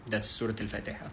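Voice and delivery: usual speaking voice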